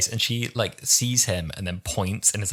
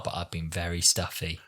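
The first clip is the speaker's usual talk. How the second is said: usual speaking voice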